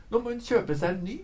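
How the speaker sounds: high, fast and cheeky; cheeky quip. A narrator's reading. Little My joking about the lost red dress, high and quick. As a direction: toss it off with glee.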